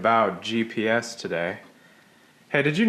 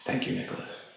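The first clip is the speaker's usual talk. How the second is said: whisper; 10 dB quieter than usual. Acted. Whispered reply.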